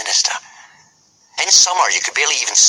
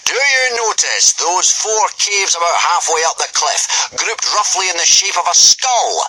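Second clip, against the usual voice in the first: booming Gobber; 10 semitones higher than usual. A narrator's reading. Gobber addressing the boys at full volume, booming. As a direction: boom it out like a hearty Viking teacher.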